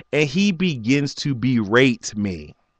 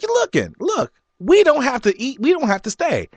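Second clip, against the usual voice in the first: exasperated high plea; 12 semitones higher than usual. Retold or imitated. To the berating manager, an exasperated high-pitched plea. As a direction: voice shooting way up, pleading.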